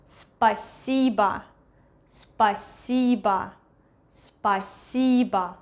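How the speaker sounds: "Спасибо" said slowly as spa-SEE-ba; slow teaching "Спасибо", spa-SEE-ba; stressed middle syllable. Shown as a demonstration. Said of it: "E is stressed here".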